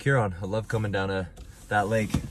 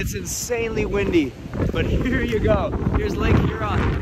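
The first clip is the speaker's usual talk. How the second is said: shouting over wind; 9 semitones higher, 4 dB louder than usual. Real, not acted. Windy beach, waves massive.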